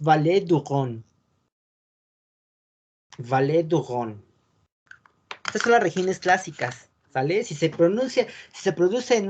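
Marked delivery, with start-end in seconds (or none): 0.0-4.2 Valais du Rhône, slow, French r; French pronunciation. Shown as a demonstration. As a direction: model the French place name. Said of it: "el Valle del Ródano es". (Valais du Rhône a la francesa)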